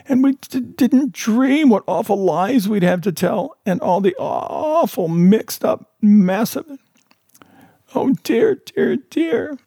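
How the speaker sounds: high, stammering sob through the words; sobbing. Acted. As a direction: break up and stutter as if crying hard. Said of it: "sobbed Missus Breed".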